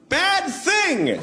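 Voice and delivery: high yelped warning; mock yell. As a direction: blurt it out as a quick warning. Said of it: "so I just yelled out".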